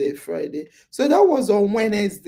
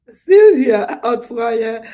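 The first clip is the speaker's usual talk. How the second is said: name called out loud and slow, stretched; calling out a name; 8 dB louder, slower than usual. Real, not acted. Host calls out a viewer's name, loud and slow. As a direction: announce the viewer's name slowly to the room.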